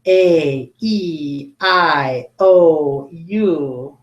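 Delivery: long vowel names said clearly one by one; 一个个清楚地发长元音. Shown as a demonstration. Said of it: "as I say the long sound".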